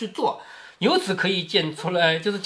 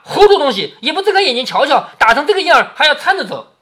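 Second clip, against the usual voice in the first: high, raised-voice telling-off; Fengjie scolding; 7 semitones higher, 6 dB louder than usual. A narrator's reading. Scolding the maids who would walk the beaten boy, angry. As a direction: scold them sharply for their stupidity. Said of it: "凤姐就骂".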